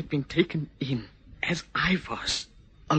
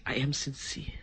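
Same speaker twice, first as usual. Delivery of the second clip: whispered sincerity; 8 dB quieter than usual. Acted. Insisting softly on his sincerity.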